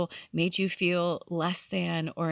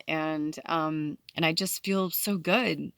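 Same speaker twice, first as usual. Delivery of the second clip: ordinary talk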